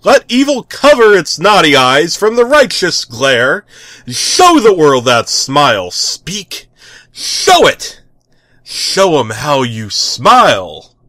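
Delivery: loud, high grand proclamation; rising heroic voice. Acted. The tick grandly urging the capybara to smile. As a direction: boom it like a superhero. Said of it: "The tick's voice rose".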